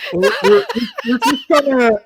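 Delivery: laughing. Real, not acted. Player laughs in character.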